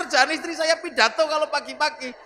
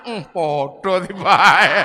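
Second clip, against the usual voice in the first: resigned Abu Dzar; 6 semitones lower, 7 dB louder, much slower than usual. Acted. Abu Dzar's resigned muttering.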